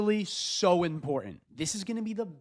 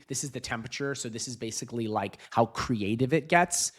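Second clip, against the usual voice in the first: ordinary talk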